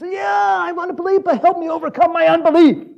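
high, loud, hammed-up begging; impassioned high-pitched outcry; desperate plea. Father begging Jesus for help.

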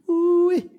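high, loud amazed whoop; high ooh-wee whoop. Real, not acted. Preacher reacting to Peter, a high loud whoop. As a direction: whoop at his nerve.

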